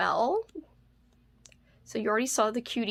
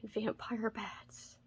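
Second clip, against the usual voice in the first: spooky whisper; 12 dB quieter, slower than usual. Real, not acted. Spooky whisper about the bats.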